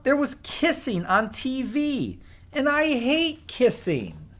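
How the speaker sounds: put-on grumpy kid listing his woes; whiny child. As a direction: sulk like a fed-up little boy. Whiny Alexander complaining at bedtime.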